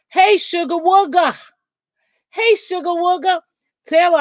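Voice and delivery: ordinary talk, nothing marked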